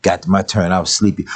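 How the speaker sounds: usual speaking voice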